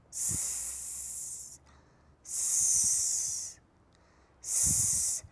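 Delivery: hissed s sound alone. Shown as a demonstration. Teacher demonstrating the letter sound, a long hissed s. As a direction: hiss a long s for the kids. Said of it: "قولي لنا الساوند بتاعه".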